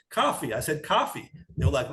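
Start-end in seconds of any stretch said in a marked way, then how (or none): 0.1-0.5 "coffee" high, with an open "ah"; high-pitched, non-New York "coffee" with open vowel; changed-up accent. Retold or imitated. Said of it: "I changed it up". 0.8-1.3 "coffee" high, with an open "ah"; high-pitched, non-New York "coffee" with open vowel; changed-up accent. Retold or imitated. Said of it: "I changed it up".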